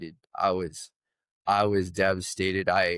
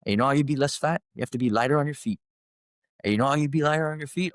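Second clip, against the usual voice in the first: fast, much higher lecturing coach; imitating coach; 6 semitones higher, faster than usual. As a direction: rattle off the drill like a coach. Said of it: "he's like".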